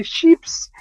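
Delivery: slow "sheeps", sh and long ee; mispronounced chips. Shown as a demonstration. Vlogger showing how we say it, a drawn-out "sheeps". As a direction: stretch chips into "sheeps", lifting at the end. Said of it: "We say it like this".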